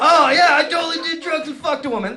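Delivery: high, fast boastful brag; mock bragger. Comic bragging about drugs and sex, a high mock boast. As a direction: ham up a cocky liar. Mocking a bragging version of himself.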